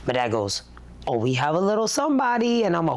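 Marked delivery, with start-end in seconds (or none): 1.1-2.7 lightly imitated dad, pitched higher; a hint of a dad's singsong reveal; dad's announcement. Dad telling daughter about her brother, lightly voiced announcement.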